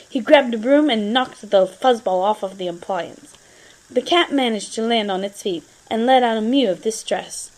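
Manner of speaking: plain reading voice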